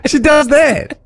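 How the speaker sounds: high-pitched, through laughter; laughing complaint. Real, not acted. Co-host telling what she does to him in stores, laughing. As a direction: protest while cracking up.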